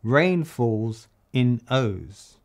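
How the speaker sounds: "Rain falls in O's" spoken slowly and evenly; slow lyric line. Shown as a demonstration. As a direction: lay out the new line slowly. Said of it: "So I'm gonna change that to".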